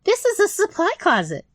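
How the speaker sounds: easy, breezy yes; high little rat voice; chirpy rat. Acted.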